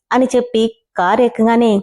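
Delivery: usual speaking voice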